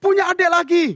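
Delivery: high, gleeful announcement; hammed-up delight; mock elation. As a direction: announce it as if it were happy news. Joke celebration of positive result.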